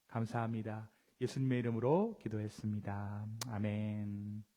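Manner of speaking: low, measured praying voice; solemn prayer. Real, not acted. Pastor opening the sermon in prayer before God, low and solemn. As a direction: pray in a low voice with long pauses.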